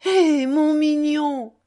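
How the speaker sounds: loud, high drawn-out exclamation of wonder; amazed cry. A narrator's reading. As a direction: call out slowly in astonishment. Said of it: "cria-t-il, émerveillé".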